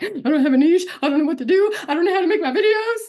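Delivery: loud, high-pitched exaggerated distress; mock panic. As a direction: wail it like an overwhelmed beginner. Acts out her old niche panic.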